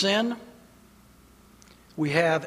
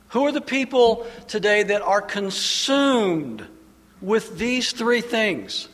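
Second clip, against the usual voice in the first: preaching at full force; 3 semitones higher, 4 dB louder than usual. Acted. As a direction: drive each question home to the congregation. Preaching climax, rhetorical questions.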